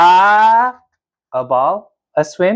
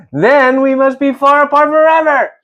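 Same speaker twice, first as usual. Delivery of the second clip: dramatic despair; 8 semitones higher than usual. Acted.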